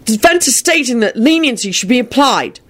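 high-pitched, forceful courtroom argument; vehement defense. A narrator's reading. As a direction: plead the case with mounting vehemence. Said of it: "shouted back vehemently".

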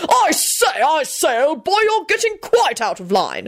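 high-pitched, blustering protest; indignant old gentleman. A narrator's reading. Richard telling Quentin he's out of line, high indignant bluster. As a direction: object in an affronted, high voice.

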